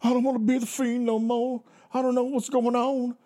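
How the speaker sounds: high, dazed mumbling wrestler voice; Bray Wyatt impression. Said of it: "Where he's all".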